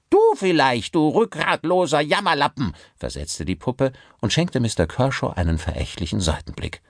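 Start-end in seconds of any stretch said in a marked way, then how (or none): 0.1-2.7 sneering puppet. Acted. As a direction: spit the insult at the ventriloquist. Puppet insults its master. (höhnische Puppenstimme)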